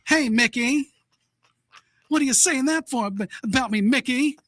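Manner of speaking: high, hammed-up old-time dame; badly faked vintage woman's voice, pitched high; horrible female imitation. Co-host as a woman back then questioning Mickey, badly faked high voice. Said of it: "these are horrible imitations".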